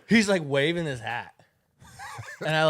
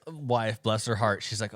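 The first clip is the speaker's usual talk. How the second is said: ordinary talk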